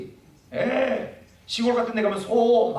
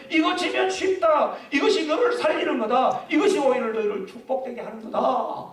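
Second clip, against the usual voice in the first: lightly voiced Jesus, upbeat and laughing; voicing the Lord; 6 semitones higher than usual. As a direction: voice Jesus promising with a smile. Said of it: "주님이 뭐라고요?".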